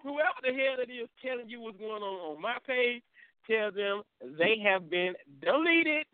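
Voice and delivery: high mock-indignant announcement, stretched at the end; hammed-up declaration of banishment; retold telling-off. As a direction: deliver a verdict slowly, rising on the last word. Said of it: "Guess what I told him?".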